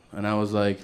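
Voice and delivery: usual speaking voice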